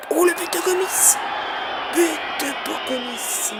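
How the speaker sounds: high, shouted goal call; excited goal call. Real, not acted. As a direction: burst out at the goal. (annonce de but survoltée)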